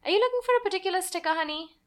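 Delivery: high, fast, sweet nurse voice; a kind nurse coaxing a sad child. Acted. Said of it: "the kind nurse asked".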